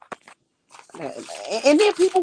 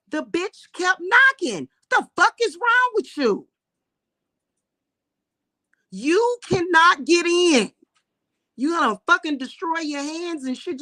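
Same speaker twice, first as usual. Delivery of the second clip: heated yelling; 9 semitones higher, 4 dB louder than usual. Retold or imitated. Recounting confronting door knocker.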